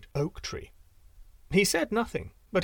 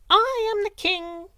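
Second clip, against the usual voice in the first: much higher, louder, slow triumphant wren; wren crowing; 5 dB louder than usual. A narrator's reading. Wren taunting the eagle from above, high and triumphant. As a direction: crow it from the sky. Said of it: "said the wren".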